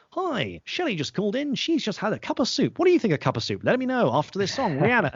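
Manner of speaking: high, chirpy radio DJ patter; radio presenter. Acted. Presenter filling a short link before the next song, hammed-up DJ voice. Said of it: "to talk every hour and just say".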